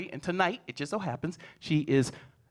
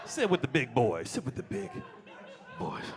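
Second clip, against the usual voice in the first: buddy-buddy dad. Retold or imitated. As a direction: welcome him in like one of the guys. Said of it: "But I've been like".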